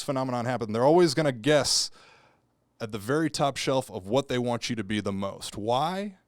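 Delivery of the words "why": ordinary delivery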